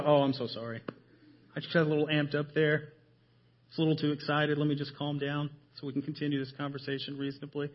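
sarcastic, quiet and fast, as though you'd really back down; fake-meek, soft and rushed apology; mock sweet apology. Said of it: "you go".

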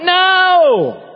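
put-on childish refusal. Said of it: "And you're like".

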